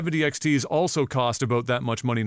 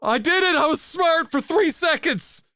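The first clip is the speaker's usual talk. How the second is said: triumphant cheer; much slower than usual. Retold or imitated. Telling a friend he found the warranty registered, yelling in high triumph. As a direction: cheer like you just won big.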